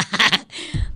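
short, loud burst of laughing; real laughter. Real, not acted. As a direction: let out one last laugh. Le da risa la confusión. (risotada corta y fuerte)

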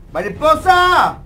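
long, high shout of "Mariposa"; calling out. Pretend. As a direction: call across the room, drawn out and high. Calling out for the butterfly.

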